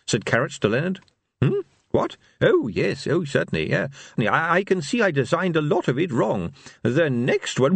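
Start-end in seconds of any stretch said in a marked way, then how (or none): 1.4-7.8 high, distracted inventor; scatterbrained, half-listening; absent-minded inventor. Leonard distracted.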